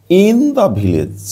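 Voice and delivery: drawn-out, high-starting model reading; slow read-out. Shown as a demonstration. Teacher reading a phrase to pupils, very slow and high. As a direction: stretch the phrase right out, falling at the end.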